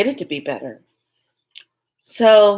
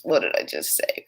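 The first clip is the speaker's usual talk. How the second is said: whispered, much lower, in a rush of alarm; panicked whisper; 10 semitones lower, 7 dB quieter than usual. Retold or imitated. Speaker realizing what she blurted to her friend, whispered panic. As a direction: breathe it out in horror.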